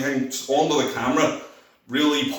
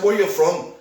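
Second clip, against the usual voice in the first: higher-pitched retold question; raised over noise; 6 semitones higher than usual. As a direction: lightly raise it over a noisy club. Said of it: "in the loudness and echo".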